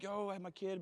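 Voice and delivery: high-pitched call without full volume; imagined yell. Retold or imitated. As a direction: call out proud news, half-yelled. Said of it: "I was about to yell out".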